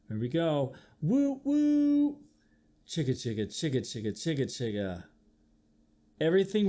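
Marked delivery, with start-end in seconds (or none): 1.0-2.2 train whistle woo. Acted. 2.9-5.0 low fading chicka chugs; low chugging train noise fading away; train chugging sound. Acted.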